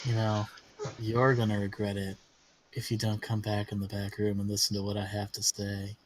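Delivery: very low, soft, insistent sleaze; Prospero, persistent schemer. Acted. Schemer luring companions to the back room, low murmur. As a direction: press them in a low murmur. Game master plays Prospero.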